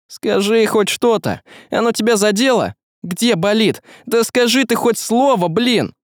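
urgent, high-pitched pleading questions; seriously frightened. Acted. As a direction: plead anxiously for any answer. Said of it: "испугавшись уже всерьёз".

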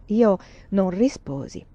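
usual speaking voice

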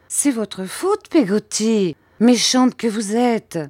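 high, drawn-out reproach; reproachful mother. A narrator's reading. Mother blaming Peggotty for her son's tears, high scolding.

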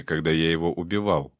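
ordinary delivery

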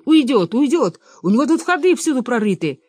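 high, loud chase cries; urgent alarm. Acted. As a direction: cry out that the prey is escaping.

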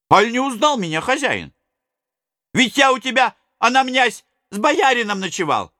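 a lightly voiced eager old servant. A narrator's reading.

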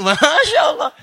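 high, loud startled Masya Allah; mother's appalled outcry; shocked mother. Pretend. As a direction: gasp it out in dismay.

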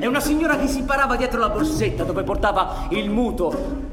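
building excited narration. Acted. Narrating the shootout at climax.

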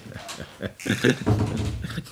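breathy chuckle of triumph; scheming chuckle. Acted. President chuckling as the marshal leaves. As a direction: laugh at the trap closing. President laughs at his success.

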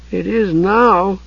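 high, slow subdued reply. A narrator's reading. Tas confirming the stronghold to Usha, subdued. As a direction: answer slowly, spirits dropped. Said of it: "Tas said, subdued".

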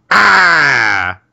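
loud, high drawn-out mock scream; acted scream. [name] screaming from the bathroom, acted scream. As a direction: let out a long falling yell. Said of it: "we hear [name] scream".

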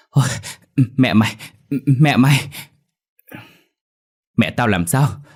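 panicked stammer rising to a loud, high cry; frantic, breathless gabbling. A narrator's reading. Mẹo winded, breaking news to Dậu, frantic. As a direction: gasp out the news in fits and starts. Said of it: "hớt hải rồi chỉ tay ra đằng sau mà nói dồn".

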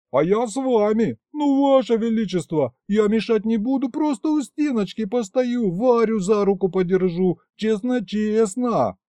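high, whiny begging; pleading. A narrator's reading. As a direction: beg like a pushy child. Begging the king to let him in.

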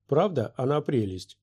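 plain reading voice

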